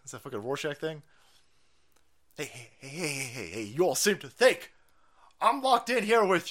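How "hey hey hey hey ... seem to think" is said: usual speaking voice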